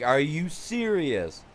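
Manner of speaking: slow, high-pitched, incredulous protest; mad disbelief. Retold or imitated. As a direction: draw out the outraged question. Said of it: "I was so mad".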